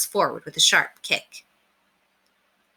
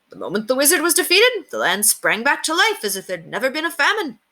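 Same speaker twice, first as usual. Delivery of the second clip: Richard explaining; 8 semitones higher than usual. Acted.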